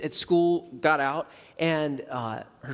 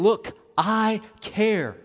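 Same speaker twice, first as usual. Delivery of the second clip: tender reassurance; 3 semitones higher, much slower than usual. Pretend. God's voice voiced by preacher.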